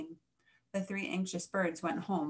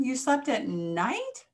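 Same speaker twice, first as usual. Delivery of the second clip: slow, drawn-out disbelief, rising high at the end; higher, stretched-out shocked question; incredulous question; 5 semitones higher, slower than usual. A narrator's reading. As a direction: ask it slowly, utterly astonished. Bats shocked at her story.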